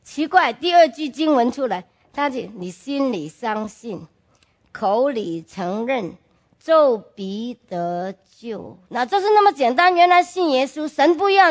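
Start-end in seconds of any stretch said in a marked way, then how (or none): 2.6-8.8 softer, slowing right down to the last words; slow scripture recitation. Retold or imitated. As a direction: let each phrase land slowly. Second verse recited, slowing. (放慢、轻声地念出经文)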